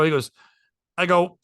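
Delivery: normal talking voice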